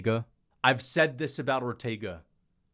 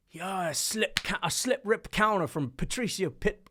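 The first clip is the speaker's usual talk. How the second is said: higher-pitched put-on British accent; 7 semitones higher than usual. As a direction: play a British hardcore fight fan, pitched up high. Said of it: "I do the frickin' British accent".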